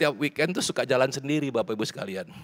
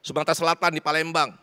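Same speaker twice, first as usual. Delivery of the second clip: impassioned preaching; 8 semitones higher, 6 dB louder than usual. Real, not acted. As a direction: preach it high and urgent to a crowd. Preaches at full force. (khotbah berapi-api dengan nada tinggi)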